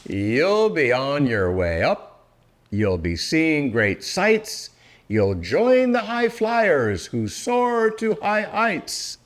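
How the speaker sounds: ordinary delivery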